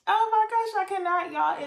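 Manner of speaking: high, loud oh my gosh; can't handle the cuteness; overwhelmed gush. Real, not acted. Vlogger overwhelmed by the wedding gift, gushing high and loud.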